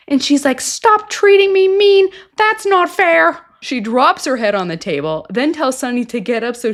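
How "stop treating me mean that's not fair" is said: put-on high, drawn-out childish protest; mock whining. Girlfriend protesting to Sonny, mock whining. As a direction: imitate a whiny complaint, pitched way up.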